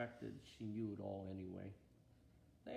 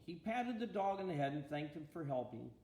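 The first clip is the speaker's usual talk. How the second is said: plain reading voice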